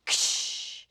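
sudden burst of "ksh"; sharp hissing noise made by mouth; imitated air-brake hiss. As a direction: mimic a truck's air brakes. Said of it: "and it goes".

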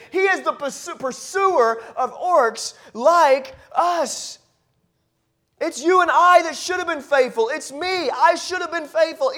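loud, rising preacher's cry; full-force preaching. Acted. Preacher to his congregation, loud and climactic. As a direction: preach it out, building to a peak.

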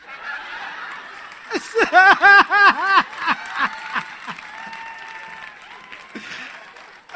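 big burst of real laughter, high and loud. Real, not acted. Preacher laughing loudly at his own hanky joke.

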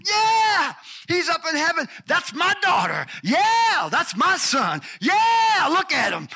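loud, high, jubilant shouts of pride; excited cheering God. Acted. God cheering from heaven over his child, high excited shouts. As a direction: cheer like a proud father in the stands.

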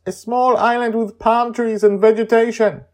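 high, loud excited shout. Acted. Joy shouting to Charlie from the condo, high and loud. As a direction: call out from the flying condo. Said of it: "Joy shouts".

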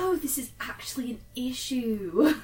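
put-on slow, slightly affected British accent; British accent trying a bit too hard to fit in; drawn-out, faintly fake British accent. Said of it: "trying a little bit too hard".

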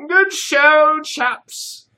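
high, loud put-on British accent; fake British accent. A narrator's reading. Curator congratulating Dave and Jacques, fake British accent. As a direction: ham up a British toff. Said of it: "obviously fake British accent".

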